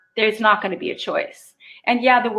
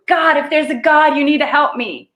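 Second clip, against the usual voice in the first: desperate plea to God; 6 semitones higher, 4 dB louder than usual. Retold or imitated. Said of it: "makes you look up and go".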